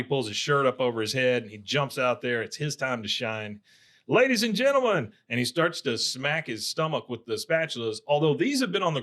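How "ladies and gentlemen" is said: high-pitched mock emcee opening; showman's announcement. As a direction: announce it like a kid playing ringmaster. Retold showman's opening to crowd.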